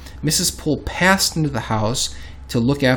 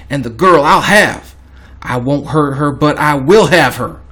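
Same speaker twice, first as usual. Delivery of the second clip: louder, slowed, emphatic declaration; insistent demand; 2 semitones higher, 6 dB louder than usual. Acted. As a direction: read his demand with forceful resolve. Reads killer's demand aloud.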